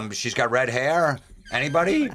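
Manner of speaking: a lightly voiced stranger looking for his fiance; disguised guy. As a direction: play the clueless fiance in a fake mustache.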